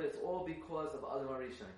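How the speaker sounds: ordinary delivery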